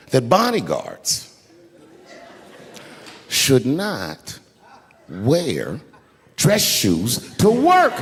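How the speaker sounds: slow reveal with long pauses; slow dramatic buildup. Acted. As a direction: drag out each word before the punch. Punchline delivered with slow pauses.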